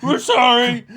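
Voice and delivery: high, loud comic whine; squeaky cartoon apology. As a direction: whimper it like a scared minion.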